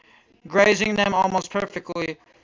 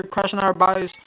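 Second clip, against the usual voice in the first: ordinary delivery, nothing marked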